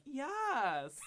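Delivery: very high mock Valley Girl squeal. Old lady agreeing with him, mock Valley Girl squeal. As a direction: imitate a delighted woman in camp Valley Girl tones.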